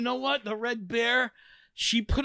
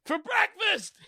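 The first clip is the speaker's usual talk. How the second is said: mock threat; 13 semitones higher, slower than usual. Rant tag.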